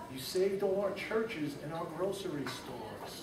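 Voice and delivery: whisper-thin little mayor; faint tiny voice. Acted. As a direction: speak as someone too small to be seen. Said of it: "The voice was so faint".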